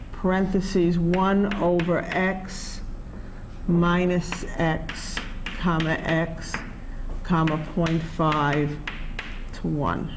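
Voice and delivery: slow keystroke-by-keystroke readout; dictating. Shown as a demonstration. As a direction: read each calculator key out slowly. Reading calculator input aloud.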